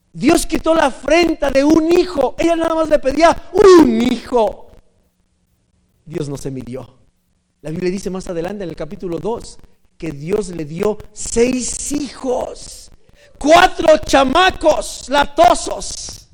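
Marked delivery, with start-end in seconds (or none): 0.1-4.7 loud, high, building to a big cry; fervent preaching shout. Real, not acted. Preacher marvels at the answered prayer, shouting fervently. 13.4-16.3 loud, high, drawn-out punchline; comic exclamation. Real, not acted. Preacher announces four pesky boys, loudly amused.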